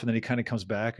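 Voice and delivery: ordinary talk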